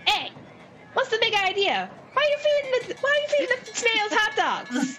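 high, indignant pixie. Acted. Pixie Flops scolding the player for feeding snails hot dogs. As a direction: squeak out your outrage as a tiny pixie. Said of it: "Flops, and he goes".

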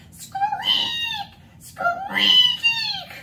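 very high, piercing squeaks; loud, high-pitched bird cry; owl screech. Acted. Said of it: "and screeched".

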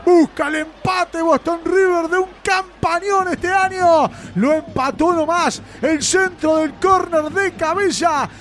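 excited goal commentary. Real, not acted. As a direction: keep the goal euphoria going. Excited post-goal recap.

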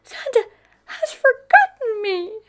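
put-on sad, very high child's whine; Peppa. A narrator's reading. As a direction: pipe up high and hurt. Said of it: "Peppa says sadly".

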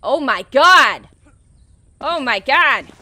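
loud, drawn-out real oh my god; shocked shout. Real, not acted. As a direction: yell it in genuine shock. Startled by game sound.

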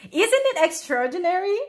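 high-pitched voice full of wonder; marveling. A narrator's reading. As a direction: show off a find with delight. Frog marvels at an ordinary pebble.

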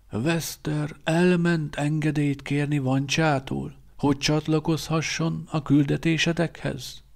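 put-on a knowing old teacher teasing; old quartermaster Szíba. A narrator's reading.

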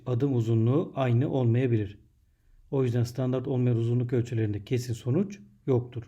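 normal talking voice

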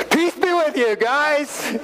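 high, breezy, buddy-like greeting; casual Jesus. Retold or imitated. Jesus surprising his frightened friends, high and cheery. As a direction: pop in and greet the guys cheerfully. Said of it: "all of a sudden he goes".

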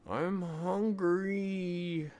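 high, very slow drawn-out whine; boy announcing hunger in a high drawn whine; whiny announcement. Acted. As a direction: stretch it out like a starving kid. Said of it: "announced Isaac".

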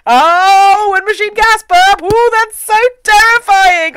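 high, loud sarcastic dread; mock terror. Host ridicules the demon's wind machine burp, mock terrified. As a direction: wail in exaggerated horror at something lame.

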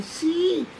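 high, squeaky-thin reply; thin little yes. Retold or imitated. Intrigued doctor answering a shouting man, thin high voice. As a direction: squeeze out a small high answer. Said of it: "el sí me salió un poco fino".

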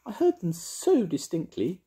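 high plaintive fairy voice; wistful high-pitched fairy; sad fairy. A narrator's reading. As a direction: lament it in a tiny high voice. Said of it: "said Mavis sadly".

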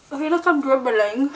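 toothbrush in mouth; talking through foamy mouth; brushing teeth. Real, not acted.

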